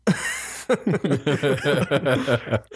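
laugh. Real, not acted. Laughs at the delivery.

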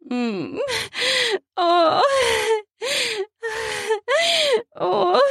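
hummed moan rising to high cries; hum, then moan high above your speech; high, spaced-out moans. Acted. Narrator acts out moans.

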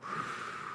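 long, soft blown-out breath; airy whoosh of breath; imitated exhale. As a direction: blow out a slow hit of smoke. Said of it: "as soon as I went".

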